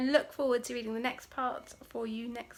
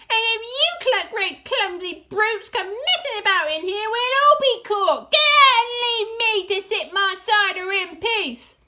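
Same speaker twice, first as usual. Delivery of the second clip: high, loud ranting; indignant shriek; 9 semitones higher, 11 dB louder than usual. A narrator's reading. Rat ordering the clumsy brutes out, shrieking. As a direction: scold them at full shriek. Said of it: "shrieked Rat".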